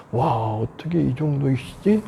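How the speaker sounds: hushed, slow, low wonder; reverent awe. Acted. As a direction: marvel softly to yourself, absorbed in admiration. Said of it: "라는 생각에 푹 빠지는 거죠".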